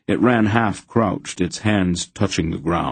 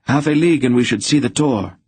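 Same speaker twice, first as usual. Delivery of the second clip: higher, pressing and tense; 6 semitones higher, 4 dB louder than usual. A narrator's reading. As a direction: urge them on under strain. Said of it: "Loldoran said tensely".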